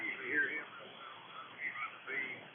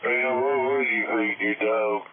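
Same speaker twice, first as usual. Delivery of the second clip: loud hail across the radio; loud, friendly shout-out on air; calling out; 2 semitones higher, 8 dB louder than usual. Real, not acted. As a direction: call out loud to a friend on the band. Calling out to another station.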